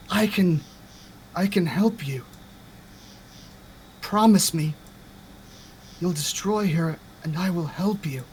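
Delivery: a hushed, coaxing plea from the orb; softly pleading spirit. Acted. Spirit in the grove softly begs Scarlet for revenge, hushed coaxing.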